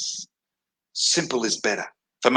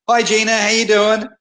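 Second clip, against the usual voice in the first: bright call out; 8 semitones higher, 4 dB louder than usual. Real, not acted. Calling out a hello to Gina in the office. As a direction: greet Gina loudly, pitched high. Greets someone passing by.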